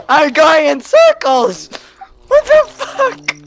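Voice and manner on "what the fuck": high stunned outburst; shocked shout. Real, not acted. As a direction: blurt it out shocked. Lost in the dark.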